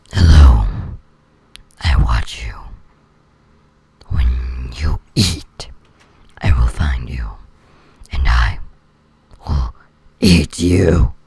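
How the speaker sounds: very low, loud creaky monster voice; slow, menacing croak, forced down low; deep voice. Shown as a demonstration. Girl scares her friends as a monster, in a forced deep voice. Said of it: "deep voice".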